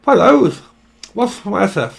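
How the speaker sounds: high, loud mock lisp. Mocking someone who talks with a lisp, high and loud. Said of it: "someone that talks with a wisp".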